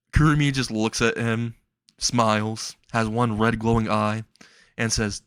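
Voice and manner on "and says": ordinary delivery, nothing marked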